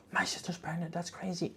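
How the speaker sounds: imitating a shocked gasp, then whispered words. Sister finding the test, in a shocked whisper. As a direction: hush it like a secret discovery. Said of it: "she's like".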